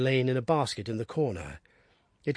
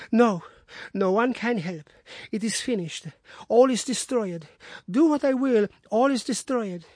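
feverish despair; 9 semitones higher than usual. Acted. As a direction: cry out that all is lost. Delirious despair.

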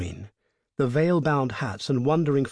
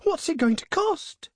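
very high-pitched, distressed wail; hammed-up high groan of dismay; anguished groan. A narrator's reading. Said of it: "groaned the other".

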